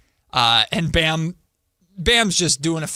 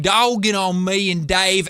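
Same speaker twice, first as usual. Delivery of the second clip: higher, teasing Tennessee drawl; imitated Tennessee accent; 5 semitones higher, 4 dB louder than usual. Host teasing co-host about her accent slip, mock Tennessee accent. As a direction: mimic a co-host's slip into Tennessee twang. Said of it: "Why did you just go Tennessee with it?".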